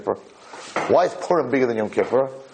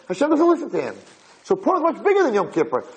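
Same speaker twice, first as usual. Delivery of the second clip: normal talking voice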